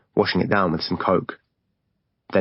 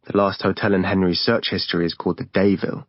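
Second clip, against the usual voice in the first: usual speaking voice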